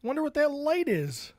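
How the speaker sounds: high, puzzled voice for his wife; imitating his wife. Retelling his wife spotting the dashboard light, high and puzzled. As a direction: play her as mildly curious. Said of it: "Courtney looks down and goes".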